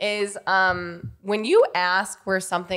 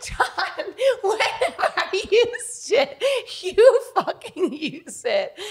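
high, slow, laughing outrage; laughing indignation; 10 semitones higher, much slower than usual. Real, not acted. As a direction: lachend und empört, viel höher und langsam.

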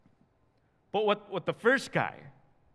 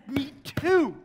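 pleased; 9 semitones higher, 6 dB louder than usual. Retold or imitated. Delighted at shared faith.

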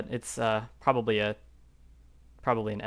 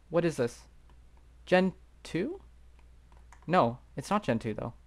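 ordinary delivery

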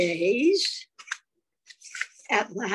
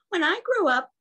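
proudly; 9 semitones higher, 4 dB louder than usual. A narrator's reading. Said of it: "he said proudly".

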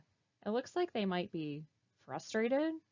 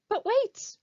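sudden surprise; 12 semitones higher, 8 dB louder than usual. Acted. Sudden discovery in story.

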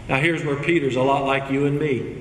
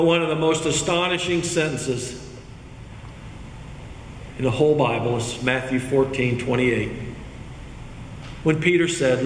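ordinary talk, nothing marked

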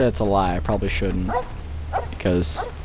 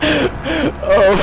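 laughter. Real, not acted. Laughing after being found.

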